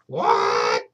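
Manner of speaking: low, loud, startled what; granny blurts her surprise; deep startled granny cry; shocked cry. Acted. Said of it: "said Granny".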